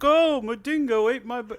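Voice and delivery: high, mock-dramatic wail; tabloid mock. Friend mocking the dingo tabloid story, high mock wail. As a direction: ham up a hysterical tabloid mother.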